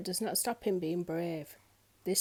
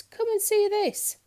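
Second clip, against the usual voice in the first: much higher, loud and slow; excited shout; 12 semitones higher, 6 dB louder, slower than usual. A narrator's reading. Miss Trouble luring the crowd over, high gleeful shout. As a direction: yell to the crowd with glee. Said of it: "she shouted at the top of her voice".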